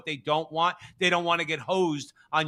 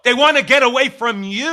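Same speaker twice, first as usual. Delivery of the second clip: raised voice; 6 semitones higher, 9 dB louder than usual. Real, not acted. Ranting at Chicago mayor on air.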